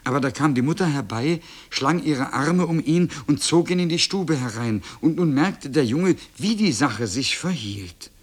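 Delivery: ordinary delivery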